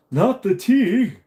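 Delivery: high, loud, slow mock cry of horror; hammed-up shriek over the tea; mock outrage. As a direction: wail it like a scandalised Brit.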